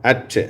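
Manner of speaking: at said clearly with an open a sound; English demo word at; model word at. Shown as a demonstration. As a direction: show them how at sounds. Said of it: "எந்தெந்த வார்த்தையில வருது?".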